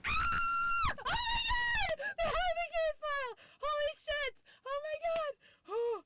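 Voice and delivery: very high, elated shouting; overjoyed shriek. Real, not acted. Just told they have the case file, shrieking with joy. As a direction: scream for joy at the news. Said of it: "explain my excitement right now".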